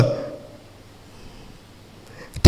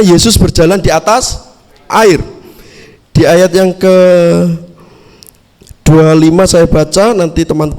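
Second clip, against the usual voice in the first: louder, steady Bible reading to the congregation; scripture reading; 3 semitones lower, 3 dB louder than usual. Acted. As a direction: read the passage out clearly, verse by verse. Reads the Bible passage aloud.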